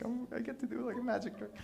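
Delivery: high, soft giddy squeal; mock giddiness, small and high-pitched; hushed excitement. Jesus eager to show the disciples a trick, hushed excitement. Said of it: "it's like he's excited".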